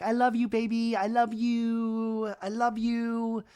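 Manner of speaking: put-on high, drawn-out baby-talk affection; imitated cooing. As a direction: coo it slowly to a baby, hammed up. [name] coos to the baby.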